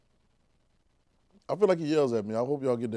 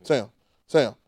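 calling a name over and over; 6 dB louder, much slower than usual. Pretend. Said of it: "you calling like that".

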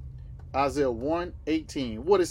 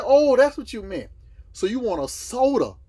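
sudden realization; 7 semitones higher, 7 dB louder than usual. Retold or imitated. Acting out waitress finally understanding him.

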